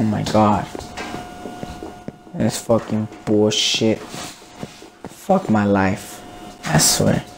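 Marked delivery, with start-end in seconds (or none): none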